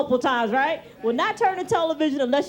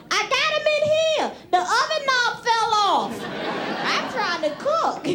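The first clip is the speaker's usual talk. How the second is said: higher mock mom call; mother yelling from the kitchen; 6 semitones higher, slower than usual. Mother calling from the kitchen about the pliers, higher. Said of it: "my mother would yell from the kitchen".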